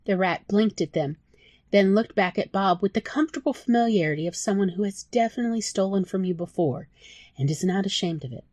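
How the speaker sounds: ordinary delivery, nothing marked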